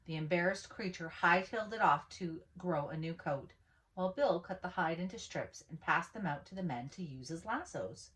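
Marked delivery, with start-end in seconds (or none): none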